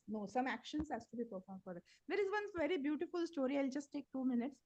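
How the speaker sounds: very faint and distant voice; speaking far too softly; barely audible. Real, not acted. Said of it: "Mataji, your voice is very low".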